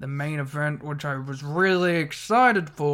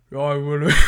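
deep-voiced Damien Priest impression; 8 dB louder than usual. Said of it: "He's got a really deep voice".

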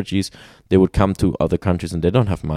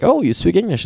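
surprised; 11 semitones higher than usual. Retold or imitated. Asking surprised locals.

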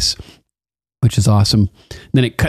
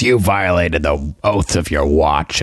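low, slow and cold, like a disappointed lord; stern father Randall; 6 semitones lower than usual. Retold or imitated. Randall scolding his son in a low, slow voice. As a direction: pronounce the sentence heavily, slowly. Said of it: "Randall must not have been stoked".

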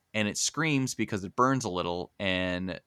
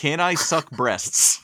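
mock Tomo quote; 5 semitones higher, 5 dB louder, slower than usual.

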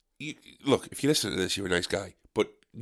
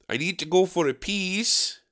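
lightly imitated Polish accent, higher pitch; higher, Polish-accented retelling with a long-ee "peas"; 4 semitones higher, 4 dB quieter than usual. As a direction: mimic a Polish speaker saying piss as "peas". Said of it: "his Polish girlfriend has a slight bit of an accent".

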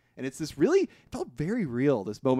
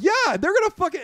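angry refusal; 6 dB louder than usual. Retold or imitated. Fred's outburst continues.

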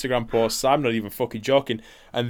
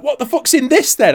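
boss's suspicion; 14 semitones higher, 8 dB louder than usual. Retold or imitated. Boss voiced, suspicious.

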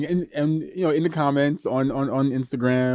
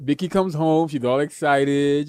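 normal talking voice

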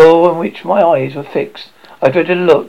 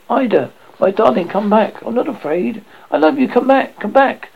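desperate calling; 7 semitones higher than usual. Acted. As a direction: cry out after her to come back. Calls after the vanished ghost.

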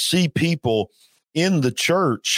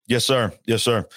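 usual speaking voice

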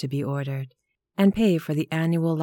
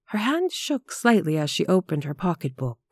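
usual speaking voice

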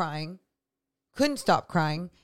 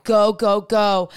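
loud, punchy self pep talk; retold hype chant, well up in volume; retold urging; 8 dB louder, slower than usual. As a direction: urge yourself on, loudly.

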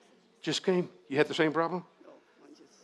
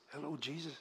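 casual conversational; 12 dB quieter than usual. Acted. Preacher acting out chatting with Jesus, quietly and casually. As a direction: drop to quiet everyday talk. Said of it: "I'm talking like this".

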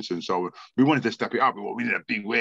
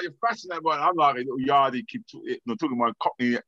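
ordinary talk, nothing marked

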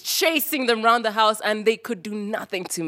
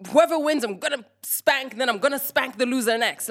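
mother's threat; 7 semitones higher, faster than usual. Retold or imitated. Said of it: "She gives the threat she always gave us".

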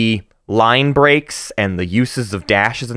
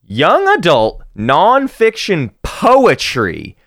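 drawn out, higher, every word weighed; slow, deliberate recitation; 7 semitones higher, much slower than usual. Retold or imitated. Reciting a mouthful of genre labels to co-host, slow and deliberate. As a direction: spell out the genre slowly, almost mock-solemn. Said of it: "and I said".

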